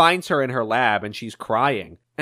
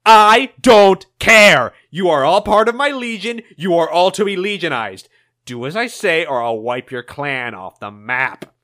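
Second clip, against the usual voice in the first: menacing threat; 7 dB louder than usual. Acted. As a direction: threaten her to her face. Eggman threatens Conquering Storm.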